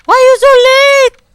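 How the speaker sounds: very high, very loud shriek; screamed complaint. Acted. Scene: prosecutor scolding Detective Gumshoe for lateness, all-out scream. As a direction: scream it at full volume.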